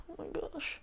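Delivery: breathless whispered oh my gosh; whispered exasperation. Real, not acted. Reader reacting to the story title, hushed exasperation.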